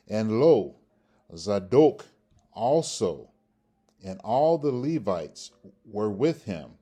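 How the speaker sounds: deliberate, emphatic Bible reading with pauses between phrases; formal read-aloud of King James verse; scripture reading. Shown as a demonstration.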